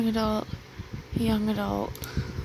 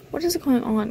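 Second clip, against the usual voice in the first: usual speaking voice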